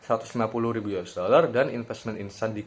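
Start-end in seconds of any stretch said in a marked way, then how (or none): none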